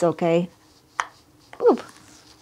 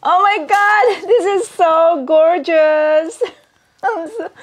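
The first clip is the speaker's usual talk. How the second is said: high-pitched, real delighted squeal; thrilled gushing; 10 semitones higher than usual. Real, not acted. Showing viewers the gorgeous new shoes, thrilled gushing. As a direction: gush in a high, amazed voice.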